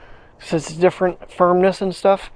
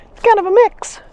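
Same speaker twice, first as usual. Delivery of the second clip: high pet talk; 14 semitones higher, 3 dB louder than usual. Real, not acted. Owner repeating the icy path report to her dog, high and cooing.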